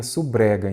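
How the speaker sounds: "subrega" blended as su-BRE-ga; fuse b and tapped r in "subrega"; run-together misreading. Shown as a demonstration. Teacher explaining what one would say without hyphen, "subrega" as su-bre-ga. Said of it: "E você falaria subrega".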